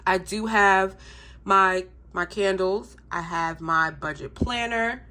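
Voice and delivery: ordinary talk, nothing marked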